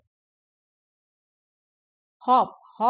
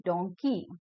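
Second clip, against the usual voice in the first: Donkey lower and joined up; syllables blended; 4 semitones lower, slower than usual. Shown as a demonstration. Teacher putting the word back together, lower blended donkey. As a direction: say donkey as one word, no gap. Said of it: "fast बोलते जाएंगे".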